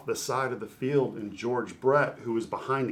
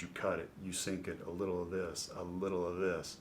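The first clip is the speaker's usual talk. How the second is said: softly retold, low-key appraisal; quiet assessment; 3 semitones lower, 7 dB quieter than usual. Pitcher retelling a legend's praise of his pitching, quiet appraisal. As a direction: list it softly, as a veteran sizing up a pitcher.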